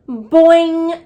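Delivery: high, loud boing sound; frog boing. A narrator's reading. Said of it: "I didn't sound much like a frog".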